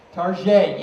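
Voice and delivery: "Target" said as "Tar-zhay", a mock French accent; mock-fancy French accent on "Target". Juggler mocking snobs: mock French accent on "Target". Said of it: "say a store's name with a French accent".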